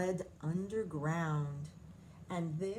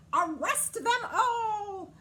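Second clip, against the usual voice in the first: much higher, loud, slow bluster; blustering command; 12 semitones higher, 9 dB louder, slower than usual. A narrator's reading. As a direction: bark the order in outrage. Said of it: "Queen Bee blustered".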